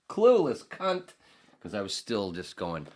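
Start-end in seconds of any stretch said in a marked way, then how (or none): 0.1-1.1 mock self-insult. Mocking his younger self.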